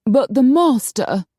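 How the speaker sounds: high, slow, indignant; huffy royal protest; huffed protest. A narrator's reading. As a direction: object in a huff, stretched out. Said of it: "Princess Joanna huffed".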